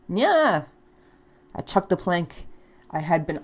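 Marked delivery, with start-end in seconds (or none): 0.1-0.7 higher, louder throwing yell; battle cry. Acted. [name] yelling while throwing the plank, fierce. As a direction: yell as you hurl it.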